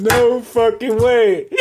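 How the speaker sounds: loud, high cry breaking out of laughter; laughing disbelief. Real, not acted. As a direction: crack up and stretch each word out. Cracking up while retelling disbelief.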